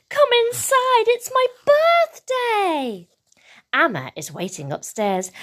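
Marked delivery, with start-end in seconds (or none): 0.1-3.1 put-on high, gleeful little-girl voice; high birthday-girl excitement; excited shouting child. A narrator's reading. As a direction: call out thrilled, stretching the last word. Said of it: "shouts Sula".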